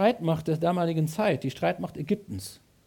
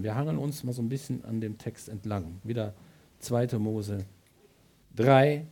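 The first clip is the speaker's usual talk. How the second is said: usual speaking voice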